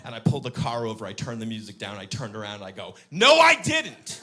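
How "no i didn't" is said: high, very loud, stretched shout; yelled denial. Retold or imitated. Dad turning round to daughter, drawn-out loud yell. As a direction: bellow each word slowly at her.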